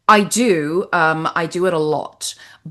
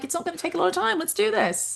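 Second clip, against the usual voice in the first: higher, fast eager urging; 13 semitones higher, 4 dB quieter than usual. Retold or imitated. Actor eager to take on a short, fast and high. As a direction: rush in, keen to start.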